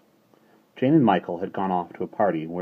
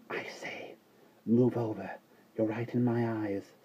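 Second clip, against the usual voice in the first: ordinary delivery, nothing marked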